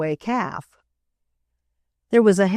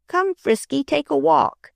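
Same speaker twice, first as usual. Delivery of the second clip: coaxing suggestion; 8 semitones higher than usual. A narrator's reading. Freddie coaxing the calf along, high and light. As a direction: coax the calf to walk. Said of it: "suggested Freddie".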